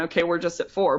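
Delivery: usual speaking voice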